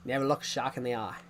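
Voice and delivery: quick, broad Australian accent; lightly done Australian outback accent. Shown as a demonstration. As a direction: rattle it off in a mock Australian accent. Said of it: "I can do an Australian accent".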